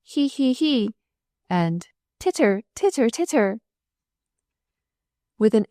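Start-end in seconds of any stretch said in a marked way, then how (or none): 0.0-0.9 high, light giggle; giggling. A narrator's reading. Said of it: "took up the same idiotic refrain". 2.2-3.6 high, light titter. A narrator's reading. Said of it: "took up the same idiotic refrain".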